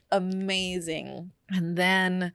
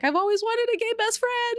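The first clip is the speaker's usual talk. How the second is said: high-pitched gleeful squeal; thrilled squeal. Retold or imitated. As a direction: gush it like a delighted best friend. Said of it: "Her response was just amazing".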